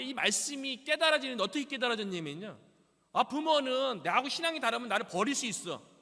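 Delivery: loud, high, impassioned sermon; full-force preaching. Acted. Preacher proclaiming scripture to congregation, loud and impassioned. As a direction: proclaim it to the whole church.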